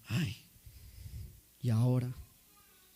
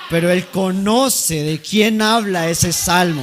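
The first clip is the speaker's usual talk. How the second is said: loud, high, impassioned proclamation; fervent shout; 4 semitones higher, 9 dB louder than usual. Acted. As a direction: proclaim it with full passion. Actor's fervent tribute climax. (proclamación apasionada a gritos)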